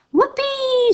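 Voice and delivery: high shrieking whoop of joy; shout. A narrator's reading. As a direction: let out a huge gleeful whoop. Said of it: "she shouted".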